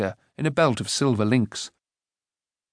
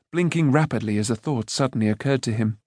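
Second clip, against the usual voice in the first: usual speaking voice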